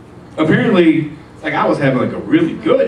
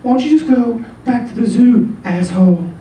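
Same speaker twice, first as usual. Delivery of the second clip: put-on higher, scolding girlfriend voice; mock-nagging her boyfriend, pitched up; angry girlfriend; 9 semitones higher than usual. Girlfriend scolding John for acting like a gorilla, high and nagging. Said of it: "she was like".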